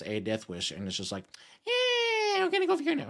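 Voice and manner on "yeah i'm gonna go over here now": high squeaky car voice. Pretend. Car with a death wish voiced.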